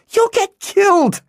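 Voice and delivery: put-on a girl shrieks at her friend in fear. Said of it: "Hala shrieked at him".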